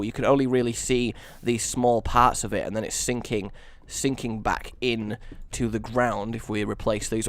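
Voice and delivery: normal talking voice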